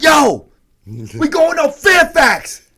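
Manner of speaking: full-throated, drawn-out rallying shout; loud, high, slow street yell. Retold or imitated. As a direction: bellow it over a whole crowd. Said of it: "And I started yelling".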